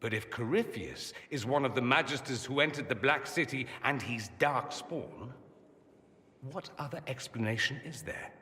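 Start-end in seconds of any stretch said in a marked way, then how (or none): 3.8-5.3 slow, weighty "Dark Spawn"; measured, pointed repeat; slow emphasis. Acted. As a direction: lean on "Dark Spawn" slowly.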